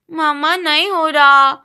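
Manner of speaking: put-on whiny child. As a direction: whine like a kid stuck on homework. Son whines over homework. (Homework से परेशान बच्चे की रोनी आवाज़)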